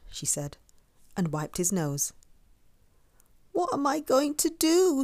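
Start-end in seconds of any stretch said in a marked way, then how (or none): 3.5-5.0 high, plaintive little wail; sniffling upset. A narrator's reading. Douglas at a loss about his hat, mild sniffly whine. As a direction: fret helplessly, pitched high. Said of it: "sniffed Douglas".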